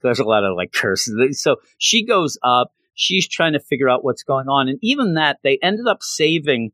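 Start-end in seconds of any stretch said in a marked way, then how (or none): none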